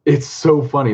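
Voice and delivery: normal talking voice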